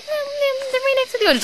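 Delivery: high, meek mock worker voice; timid store worker. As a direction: answer timidly in a high squeak.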